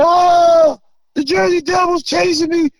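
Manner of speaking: high, loud frantic yell, imitating the brother; retold panicked yell. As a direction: yell it out as if chased by something. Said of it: "yelling and screaming".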